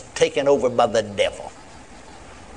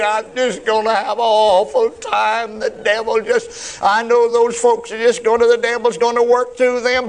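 slow, drawn-out mock wail, climbing higher; whining and crying; 7 semitones higher, 3 dB louder, slower than usual. Preacher mimicking a defeated prayer, whining and crying. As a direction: whine out a pleading prayer, hammed-up. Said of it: "instead of whining and crying".

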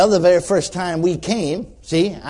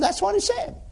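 much higher, thin imitated answer; retold reluctant agreement. Sinner admits to preacher what verse said, high-pitched imitation.

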